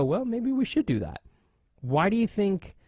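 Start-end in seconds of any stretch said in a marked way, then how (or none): 0.0-1.2 mock official. Mock NASCAR officials deciding.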